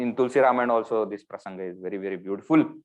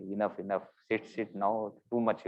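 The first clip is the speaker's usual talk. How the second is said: soft, embarrassed plea to stop; embarrassed hushing; 3 semitones lower, 10 dB quieter than usual. Retold or imitated. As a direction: hush your brother gently and much softer. Rama embarrassed, hushing his brother.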